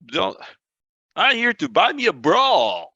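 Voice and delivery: high, hammed-up Southern accent; exaggerated Southern demo; overemphasized Southern accent. Shown as a demonstration. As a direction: lay the Southern accent on thick, pitched high. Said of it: "you could overemphasize that accent".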